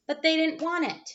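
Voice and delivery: usual speaking voice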